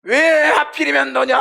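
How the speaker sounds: bereaved mother's drawn-out howl; high, slow wail building to a scream; anguished wailing. Acted. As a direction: cry out against fate in grief. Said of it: "하면서 막 울부짖는데요".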